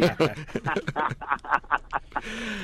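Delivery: long, soft laugh; laughter. Real, not acted. Guest laughs at the host's joking comeback, soft laughter. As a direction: let a quiet laugh run on.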